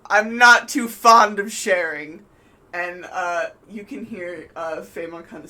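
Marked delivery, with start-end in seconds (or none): 0.1-2.2 loud, projected villain voice; theatrical threat called across the water; villain's retort. Acted. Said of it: "he's like".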